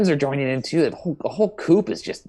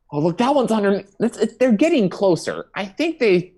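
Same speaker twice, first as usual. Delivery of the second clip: panicky; 6 semitones higher, 5 dB louder than usual. Acted. Panics as chickens approach.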